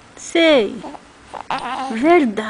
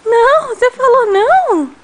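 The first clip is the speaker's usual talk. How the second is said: high, loud delighted surprise; surprised; 7 semitones higher, 5 dB louder than usual. Real, not acted. Bebê parece dizer não. (surpresa alegre e aguda)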